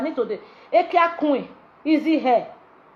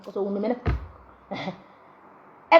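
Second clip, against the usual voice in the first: ordinary talk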